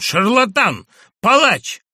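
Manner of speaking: high, loud outraged cry; a patient's high, loud curse at the doctor; furious shout. A narrator's reading. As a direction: spit out the insults in fury. Madman cursing the doctor.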